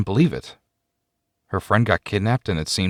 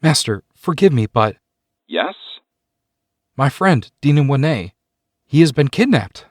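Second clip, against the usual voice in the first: urgent, higher and louder; blurted out; 6 semitones higher, 4 dB louder than usual. Acted. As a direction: blurt it out in a rush. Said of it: "suddenly blurted out".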